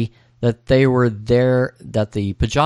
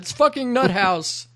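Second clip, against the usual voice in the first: high, mock-exasperated grumble; retold incredulous complaint; Chad; 13 semitones higher than usual. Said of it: "says Chad".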